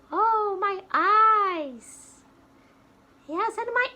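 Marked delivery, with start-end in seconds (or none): 0.1-2.2 put-on higher, slow, drawn out for kids. Shown as a demonstration. Teacher to kids, a slow drawn-out oh, pitched up. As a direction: stretch the oh out slowly.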